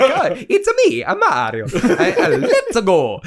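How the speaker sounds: hammed-up Mario Italian accent; bad Italian accent. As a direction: do a cartoonish Italian plumber voice. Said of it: "an Italian accent, like, a really bad one".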